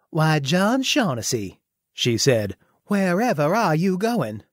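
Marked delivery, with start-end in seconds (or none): 0.1-1.6 slow, high Southern belle drawl. Acted. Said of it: "That's not how John Shaughnessy would talk". 2.8-4.4 high, sweet Southern belle voice; coy Southern belle question; slow southern drawl. Acted. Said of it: "That's not how John Shaughnessy would talk".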